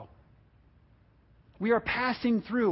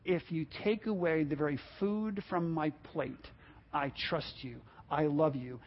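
tender prayer; 6 semitones lower, 11 dB quieter than usual. Acted. Habakkuk's quiet prayer of trust.

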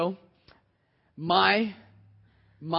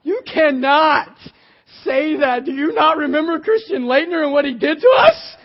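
high, loud cracked shout; frantic fan's outburst; panicked yell; 6 semitones higher, 9 dB louder than usual. Retold or imitated. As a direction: yell it in mock panic at your wife. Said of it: "I'm like".

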